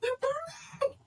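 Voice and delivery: very high, loud terrified scream; high frantic crying scream; panicked screaming. Acted. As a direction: shriek like she's waking in a fire. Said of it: "and she's like".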